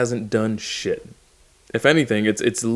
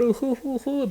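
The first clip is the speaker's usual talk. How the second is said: very high-pitched mock laughter; high hooting mock laugh. Mock laugh within the impression.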